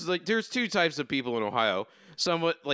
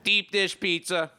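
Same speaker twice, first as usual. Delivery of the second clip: bad Chicago impression with a slow punchline; exaggerated Chicago accent for laughs; terrible Chicago accent; slower than usual. Shown as a demonstration. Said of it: "This is a terrible Chicago accent".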